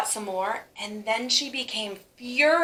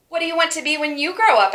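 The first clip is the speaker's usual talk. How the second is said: eager question; 7 semitones higher, 7 dB louder, faster than usual. Acted. Teacher asks the class.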